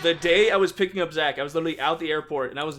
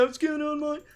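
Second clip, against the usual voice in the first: higher, cheery greeting; imitating a friend's greeting; 10 semitones higher, 3 dB quieter than usual. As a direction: mimic the friend answering the call. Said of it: "he was like".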